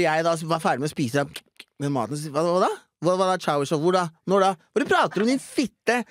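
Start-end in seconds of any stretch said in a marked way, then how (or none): 2.3-6.0 higher, louder baffled questions; mock confused protest. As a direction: act baffled and rude. Retold confused protest to friend. (forvirret protest, lett spilt)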